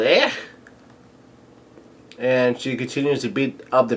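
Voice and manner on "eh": short, high yelp; mock startled yell. Luffy reacting to Big Mom's chant, mock startled yelp. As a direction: let out a quick rising cry. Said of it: "yells out".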